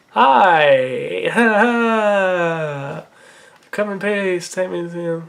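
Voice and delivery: hammed-up alien hello with a laugh; drawn-out, goofy alien voice; alien greeting. Aliens greeting the child hero, silly alien voice.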